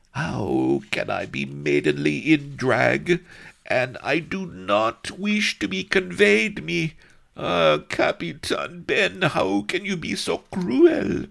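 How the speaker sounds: high, slow swooning damsel; girlish voice. A narrator's reading. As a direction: plead like a lovesick girl. Girl pleading in drag.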